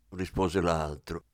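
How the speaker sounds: plain reading voice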